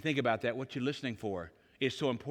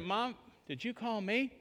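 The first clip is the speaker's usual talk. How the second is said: higher, softer mock-innocent question; feigned innocence; 7 semitones higher, 6 dB quieter than usual. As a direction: play the clueless child, slowly and sweetly. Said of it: "act like I didn't hear it".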